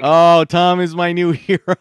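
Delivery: loud, slow delighted laugh. Real, not acted. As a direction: laugh with joy at the caller. Host delighted by caller.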